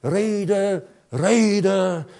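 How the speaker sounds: strained parody of pious reading, building to a loud wail; mock cramped piety. Preacher mocks forced Bible reading, cramped and straining. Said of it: "das ist nichts krampfhaft".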